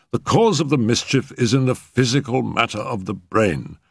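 protest, then explain in measured phrases; expostulating. A narrator's reading. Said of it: "expostulated the physician".

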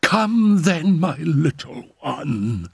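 high call, slowing and softening; yearning cry. A narrator's reading. Mother summons her child to her arms, yearning cry. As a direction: cry out, then draw out the endearment. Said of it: "cried Madame X".

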